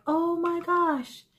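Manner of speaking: high, drawn-out awe; retold amazement. Shopper spotting the cross, high and amazed.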